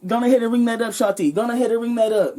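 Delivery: a hint of an Indian accent from a pushy customer. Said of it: "I don't do an Indian accent well".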